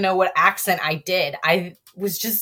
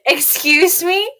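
higher, slow, offended excuse me; indignant disbelief; 11 semitones higher, 5 dB louder, slower than usual. Retold or imitated. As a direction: act shocked at the rule. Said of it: "I was like".

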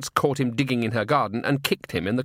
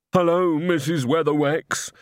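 slow, higher-pitched character voice; Walter's simple greeting; 5 semitones higher, 3 dB louder than usual. A narrator's reading. Walter kneeling before Granny, meek greeting. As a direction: greet her slowly in a raised voice.

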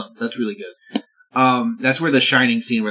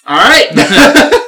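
high, loud, rising yelp; mock panicked surrender; 10 semitones higher, 9 dB louder than usual. Preacher surrendering to granny's shotgun, mock-alarmed yelp. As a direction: give in hastily, pitched way up.

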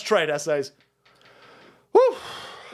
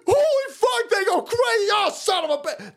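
much higher, shouting in disbelief; high excited rant; 13 semitones higher than usual. Real, not acted. Host ranting to chat, high and shouting. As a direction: go way up, almost shouting.

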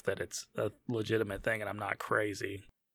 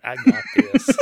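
mock Shane line; 12 semitones higher, 12 dB louder, slower than usual. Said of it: "Shane's over here like".

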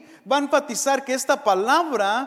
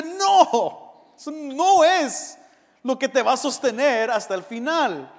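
higher and louder, firm no; firm rebuke; 4 semitones higher, 4 dB louder than usual. Retold or imitated. Pablo rebukes the boastful Corinthian church, higher, louder and firm. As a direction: Pablo shuts them down with authority.

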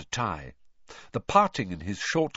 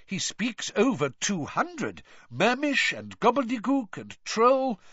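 excited; 13 semitones higher than usual. A narrator's reading. Percy praising his idol's languages, excited. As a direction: gush in a high, eager voice. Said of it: "positively writhing with excitement".

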